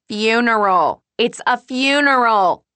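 loud, high outburst, stretched vowels; indignant rant. A narrator's reading. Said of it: "Molly ranted".

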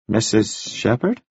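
hesitant, questioning rise at the end; uncertain. A narrator's reading. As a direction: ask it tentatively, unsure of the response. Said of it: "said Devlin uncertainly".

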